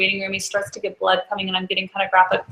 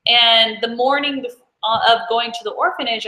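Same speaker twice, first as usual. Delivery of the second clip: normal talking voice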